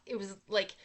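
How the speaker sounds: normal talking voice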